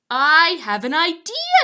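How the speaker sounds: excited eureka; eureka exclaim. Acted. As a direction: burst out with the idea. Hudson's sudden idea.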